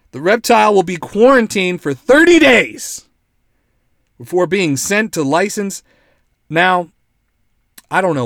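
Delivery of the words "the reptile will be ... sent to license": loud, higher announcer swell; hammed-up outraged news read; mock-dramatic read. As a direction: blow up the news into a proclamation. Mock-dramatic reading of article.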